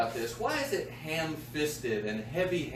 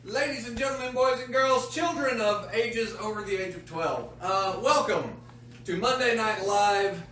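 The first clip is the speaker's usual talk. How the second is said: host announcer; 6 semitones higher than usual. Acted. Host introduces the show.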